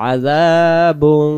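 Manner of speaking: আযাবুন drawn out, ending in a long nasal hum; hidden nun. Shown as a demonstration. Teacher showing concealed nun, long nasal ending on আযাবুন. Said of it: "নুন সাকিনকে গোপন করে পড়তে হবে".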